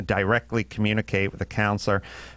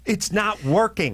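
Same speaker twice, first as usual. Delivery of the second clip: ordinary talk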